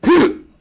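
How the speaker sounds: sharp high gasp; startled loud gasp. Acted. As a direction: gasp in mock shock. Sudden startled reaction.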